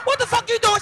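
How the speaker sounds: high, loud startled yell. Retold or imitated. Husband walking in on wife in the dark, yelling. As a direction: shout in shock at what you walked in on. Catching wife with her toy.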